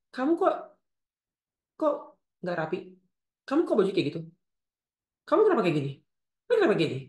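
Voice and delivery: acted nagging, pitch jumping at each complaint; critical mother. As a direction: find fault with the child repeatedly. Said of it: "yang kritis". (ibu cerewet mengkritik)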